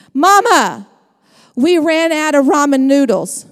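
loud, mock-dramatic child's plea; whiny kid. Mother imitating her child about ramen, mock-dramatic loud plea. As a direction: play the kid announcing a tragedy.

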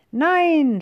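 high, loud cry of no; terrified scream. A narrator's reading. Witch screaming no at the dragon, high and loud. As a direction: scream in terror. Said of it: "schreit die Hexe".

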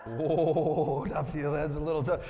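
ordinary talk

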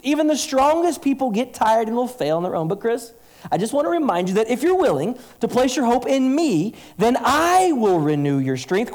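high, loud voice of God pleading with Chris; God speaking. Acted. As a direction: speak as God to a tired servant. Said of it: "Let me replace the word Jacob".

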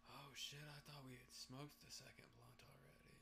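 hushed whisper. Real, not acted. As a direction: breathe it out under your breath. Stoned aside, whispered.

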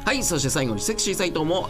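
ordinary talk, nothing marked

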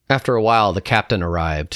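usual speaking voice

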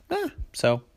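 high, drawn-out unimpressed "Ah, so". A narrator's reading. Little sister shrugging off big sister's boast, unimpressed. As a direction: sound totally unimpressed. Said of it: "She wasn't too impressed".

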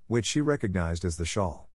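ordinary delivery, nothing marked

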